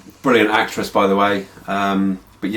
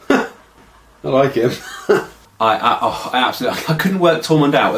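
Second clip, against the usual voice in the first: laughing; 4 semitones higher than usual. Real, not acted. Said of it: "laughs at the clip".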